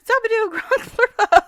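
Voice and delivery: very high, slow, loud gibberish; exaggerated Sim voice; Simlish. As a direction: draw out Sim babble high and silly. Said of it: "you really do know the Simlish".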